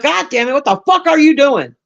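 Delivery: high, exasperated parent yelling; a hint of a fed-up mom shouting; mock angry yell.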